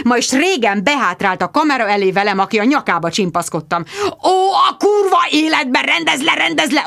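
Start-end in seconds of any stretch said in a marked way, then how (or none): 4.2-6.8 high, frantic shout; furious yelling. A narrator's reading. Richard yells at Bridget to sort out the horse. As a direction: scream orders in exasperation. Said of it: "ordította Richard".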